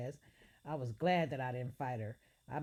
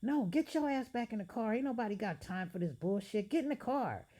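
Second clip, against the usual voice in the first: loud, high angry order; furious demand; 7 semitones higher, 4 dB louder than usual. Acted. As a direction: bark it at her to get back in. Angry boyfriend ordering her back.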